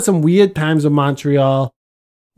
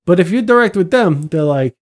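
ordinary talk

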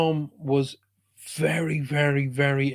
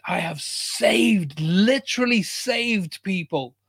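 slow, louder, higher cry to the sky; raised, drawn-out angry shout; retold shout; 5 semitones higher, 4 dB louder, slower than usual. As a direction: hurl it up at the guides. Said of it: "and I shouted".

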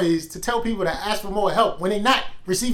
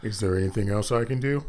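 mock rep voice; 10 semitones lower, 6 dB quieter than usual. Mocks the rep's scripted line.

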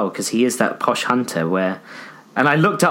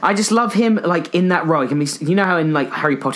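normal talking voice

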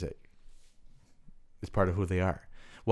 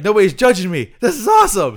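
high, loud and thrilled; gleeful; 9 semitones higher, 11 dB louder than usual. Retold or imitated. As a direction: crow with delight at being anonymous. [name] voiced, thrilled.

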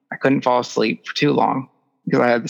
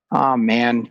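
usual speaking voice

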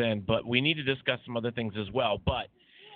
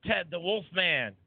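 radio host intro; 6 semitones higher than usual. Acted. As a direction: announce the show like a radio host. Host opening radio show.

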